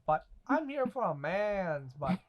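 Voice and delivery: soft, lightly voiced impression; Booty Warrior. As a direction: quote him quietly, stretching the last words. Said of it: "this universe's booty warrior".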